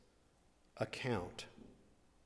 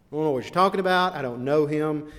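higher, louder and fast, brushing them off; panicked denial; 4 semitones higher, 7 dB louder, faster than usual. Acted. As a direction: blurt it out twice, getting frantic. Peter denies again, escalating.

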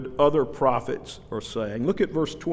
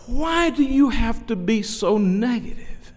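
a higher, put-on voice for the woman; mimicking the complaining woman; 7 semitones higher than usual. Preacher voicing a woman who calls him negative. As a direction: lightly play the offended churchgoer.

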